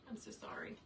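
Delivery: breathy hushed sorry; quick whispered aside; whispered apology. Real, not acted. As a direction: whisper it under your breath.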